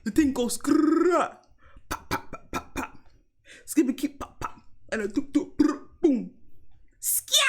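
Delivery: mock ad-lib bangs and rolled skrrr; imitated rap gun noises. Londoner imitating a comic rapper, playful gunfire noises. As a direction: fire off percussive mouth noises. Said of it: "the guy who said the thing goes".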